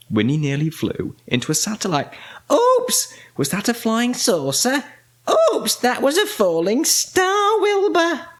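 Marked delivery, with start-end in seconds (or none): 2.5-8.3 high, excited exclamations; startled witch. A narrator's reading. Winnie to Wilbur while steering the rocket, high startled cries. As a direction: call out each near miss high and startled. Witch dodging things in space.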